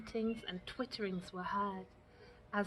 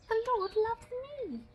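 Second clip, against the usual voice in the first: tiny bird voice; 12 semitones higher, 3 dB louder than usual. A narrator's reading. Robin repeats, finding his voice.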